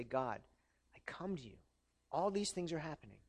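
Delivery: soft, low humble prayer; praying to God. Acted. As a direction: quietly hand it over to God. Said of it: "And we can say".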